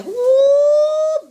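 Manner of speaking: a high, loud whoop of joy; excited woo. Real, not acted. Cook shouting for joy over her dish, excited woo. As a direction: whoop it out, thrilled by the food.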